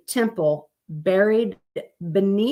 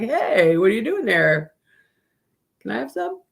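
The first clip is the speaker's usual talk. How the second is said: lightly voiced fish, rising higher; a nosy fish at the glass, higher by the end; voicing a fish; 5 semitones higher than usual. Fish peering at diners through the window, mock indignant.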